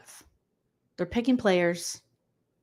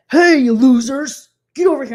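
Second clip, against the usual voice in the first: mock rude yell; 4 semitones higher, 9 dB louder than usual. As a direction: bark at them like a rude host. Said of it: "So he's like".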